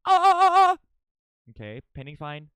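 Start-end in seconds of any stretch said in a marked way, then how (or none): 0.1-0.8 much higher, much louder cries; excited yelps. Real, not acted. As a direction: yelp in delight.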